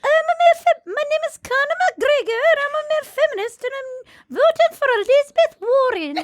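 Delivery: absurdly high bad Irish accent; Conor McGregor. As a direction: push the hopeless Irish voice way up high. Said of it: "You're getting more high-pitched".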